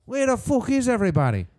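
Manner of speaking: put-on high-pitched cocky entrance call, botched Irish accent; Conor McGregor. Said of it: "You should probably work on that accent".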